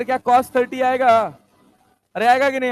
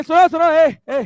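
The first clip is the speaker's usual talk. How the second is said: raised voice to settle the class; teacher calling over the noise; 4 semitones higher, 6 dB louder than usual. Real, not acted. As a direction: call the students to order, loud. Calling restless students to order.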